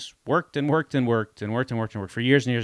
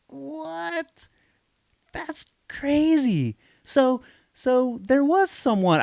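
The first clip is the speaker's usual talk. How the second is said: way higher, slow, astonished; stunned disbelief; much slower than usual. Real, not acted. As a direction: react like you can't believe it. Learns buyer uses his name.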